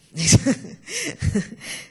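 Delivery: short sheepish laugh; embarrassed laugh. Real, not acted. Embarrassed at unreadable text.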